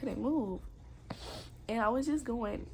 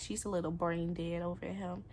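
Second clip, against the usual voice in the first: normal talking voice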